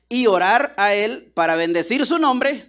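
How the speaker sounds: ordinary talk, nothing marked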